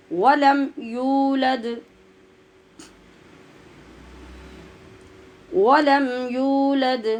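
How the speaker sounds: melodic Quranic recitation. Shown as a demonstration. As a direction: recite each word long and measured. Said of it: "recitation demo".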